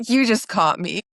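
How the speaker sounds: ordinary talk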